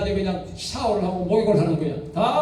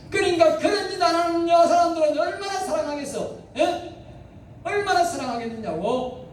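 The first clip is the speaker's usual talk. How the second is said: high, sing-song preaching; fervent exhortation; 10 semitones higher than usual. Acted. Preacher exclaiming God's love to the congregation. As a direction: rouse them with a lilting question.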